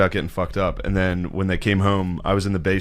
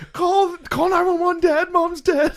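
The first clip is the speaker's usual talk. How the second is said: much higher, frantic mock panic; panicked sister; slower than usual. Sister yelling for mom at the bottom of the stairs, high mock panic. Sister calls to drunk dad.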